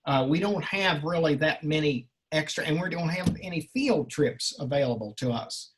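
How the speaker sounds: ordinary delivery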